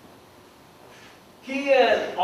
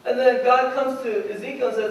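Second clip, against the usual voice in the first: usual speaking voice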